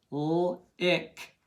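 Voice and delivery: "L-ick" with the l held apart from "ick"; sounded out. Shown as a demonstration. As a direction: break "L-ick" into l, then ick. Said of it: "tap each part".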